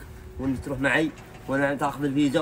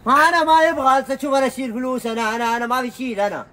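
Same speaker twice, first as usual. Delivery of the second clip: high, loud broken-Arabic worker, insisting he pays; a pidgin-speaking labourer objecting loudly; foreign worker protesting; 10 semitones higher, 6 dB louder than usual. Acted. (عامل أجنبي يحتج بعربية مكسرة بصوت عالٍ)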